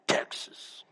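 "Texas" breathed out soft and unvoiced, full of pride; proud breathy Texas. Shown as a demonstration. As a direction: savor "Texas" like a secret treasure. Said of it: "And you always say it like that".

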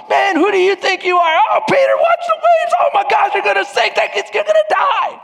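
very high, loud panicky shouting; hammed-up crowd yelling in alarm; freaking out. Twelve disciples in the boat yelling at Peter on the waves, frantic high shout. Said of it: "they're sitting there freaking out".

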